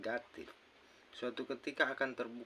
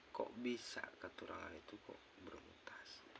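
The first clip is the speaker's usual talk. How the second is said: whispered disbelief; 2 semitones lower, 9 dB quieter than usual. Retold or imitated.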